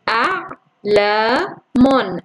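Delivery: أعلام drawn out very slowly, syllable by syllable; slow syllable split. Shown as a demonstration. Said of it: "سوف أبدأ". (أعلام ببطء شديد مقطعًا مقطعًا)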